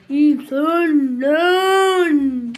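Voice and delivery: braying shout. Acted. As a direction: bray it out like a donkey. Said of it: "eşek gibi bağırmasana". (eşek gibi uzatarak bağırma)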